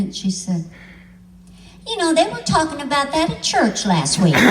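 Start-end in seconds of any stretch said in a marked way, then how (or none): 1.9-4.3 high, louder voicing of mom; matter-of-fact mom. Mom on the phone, matter-of-fact and louder. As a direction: deliver mom's line dead serious. Mom's deadpan reply punchline.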